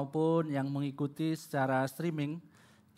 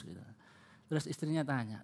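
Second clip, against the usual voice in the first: ordinary delivery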